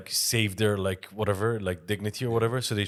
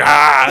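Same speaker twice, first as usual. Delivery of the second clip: louder, higher mock roar; loud tiger roar; 6 semitones higher, 19 dB louder than usual. The tiger attacking Tyson, loud mock roar. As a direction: let out a big tiger snarl. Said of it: "then the tiger going".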